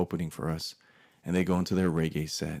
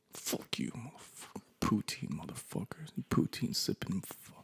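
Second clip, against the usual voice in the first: whispered grudge, far softer; muttered cursing; 2 semitones higher, 10 dB quieter than usual. Acted. Thinking of Canada and cursing it, whispered muttering. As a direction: curse them under your breath.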